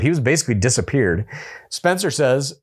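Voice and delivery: usual speaking voice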